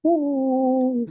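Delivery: high hooting whale call; whale noise. Pretend. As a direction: hoot like a dying dog. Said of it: "You sound like a dying dog there".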